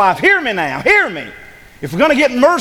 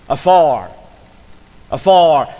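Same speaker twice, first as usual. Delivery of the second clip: "Afar" said louder with a falling tune; stressed afar; 4 semitones lower, 5 dB louder, slower than usual. Shown as a demonstration. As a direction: land hard on "Afar". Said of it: "what's that next word say?".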